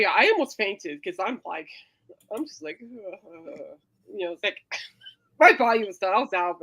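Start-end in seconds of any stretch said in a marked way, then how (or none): 2.8-3.8 soft drawn-out ugh of nausea; weak disgusted ugh; quiet sick groan. Retold or imitated. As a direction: groan as if about to faint. Retelling faintness.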